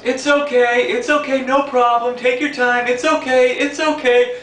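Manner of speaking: high, sing-song chorus of reassurance; crowd of passengers. Retold or imitated. Passengers reassuring the bus driver, in a high sing-song chorus. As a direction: chirp it like the whole bus agreeing. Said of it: "everybody on the bus goes".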